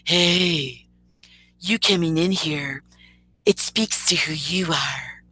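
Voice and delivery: slow, drawn-out mock version of his voice; mocking impersonation. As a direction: drag the words out as you imitate him. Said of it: "Or let me get his voice down".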